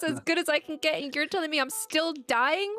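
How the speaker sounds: high-pitched, heated comic protest; mock exasperated rant. Acted exasperated protest at the game.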